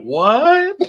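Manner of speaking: high, loud disbelieving what; shocked outsiders; incredulous what. Retold or imitated. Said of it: "The Canadian guys are like".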